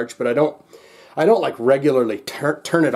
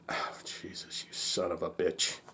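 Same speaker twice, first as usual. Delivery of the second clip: muttered curse; 8 semitones lower, 17 dB quieter than usual. Real, not acted. Vlogger cursing his phone under his breath. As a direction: swear under the breath, almost voiceless. Phone volume drops again.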